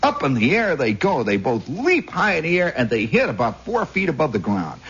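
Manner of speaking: ordinary talk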